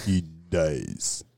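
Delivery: cold Russian indifference, slowly; Drago impression. Quoting Drago to a friend, low deadpan Russian. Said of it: "You're not Ivan Drago".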